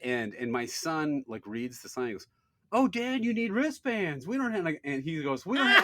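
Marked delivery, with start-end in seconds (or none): 2.7-4.7 put-on high, loud kid voice; mock child alerting his dad; imitating his son. 5.4-5.8 high, loud mock kid voice; hammed-up child announcing it; imitating his son.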